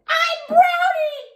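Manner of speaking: high, slow joyful cry. A narrator's reading. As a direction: spin and sing out the name. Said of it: "really joyful".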